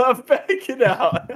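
laughing it out high. Real, not acted. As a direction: giggle through the words. Repeats line while laughing.

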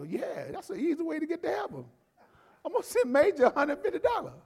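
high, eager mock of a gullible believer; high-pitched gullible churchgoer. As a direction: play the naive follower happily paying up. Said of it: "that's some gullible folks".

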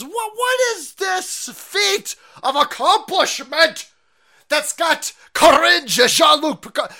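high, loud, slow hammed-up shout; mock outraged shout. Ranting mock outrage at clip.

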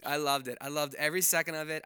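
usual speaking voice

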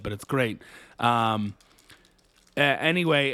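ordinary talk, nothing marked

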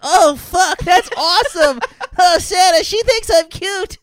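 mock kid voice, overjoyed; six-year-old boy voice. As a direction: squeal it like a thrilled little kid. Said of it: "Rudolph has the voice of a six-year-old boy".

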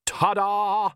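high, showy flourish; theatrical ta-da. A narrator's reading. Count showing off to Vlad, theatrical ta-da. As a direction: throw it out like a stage magician. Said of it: "flung out his hands theatrically".

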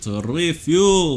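slow mock Russian vampire; high, slow mock Russian accent. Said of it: "Russian accent".

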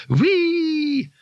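long, very high-pitched squeal; gleeful whee. Acted. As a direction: sing out a giddy spell noise. Dazed wizard playfully casting a spell.

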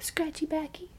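put-on high, soft babyish request; imitating best friend. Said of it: "she'd be like".

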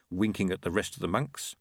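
plain reading voice

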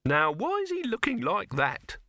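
high-pitched querulous question; puzzled Silverfish. A narrator's reading. Silverfish wondering at the actor, high and querulous. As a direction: ask in a high, put-on voice.

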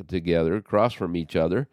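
usual speaking voice

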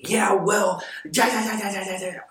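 high, loud, mocking brush-off; sarcastic nagging yeahs; dismissive yeah. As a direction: wave the apology away. Acting out dismissive other person.

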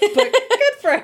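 high laughing squeak; squeaky voice. Real, not acted. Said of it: "My voice just squeaked really loud".

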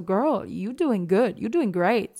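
slow, drawn-out encouragement; self-encouraging pep talk. Pretend. Cheering herself on instead of beating herself up, slow and encouraging. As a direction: tell yourself you're doing great, slowly. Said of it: "anstatt mir einfach mal zu sagen".

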